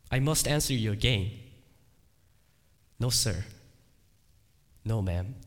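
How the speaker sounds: usual speaking voice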